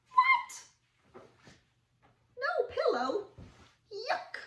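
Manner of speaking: high, loud mock outrage; shocked. Reacting for children to no pillow, high mock shock. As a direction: squeal it in disbelief.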